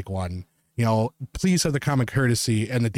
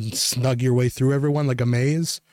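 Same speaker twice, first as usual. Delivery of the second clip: ordinary talk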